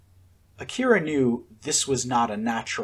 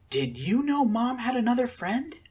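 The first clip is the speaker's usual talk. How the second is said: put-on girl voice; 10 semitones higher than usual. A narrator's reading. Akira voiced in story.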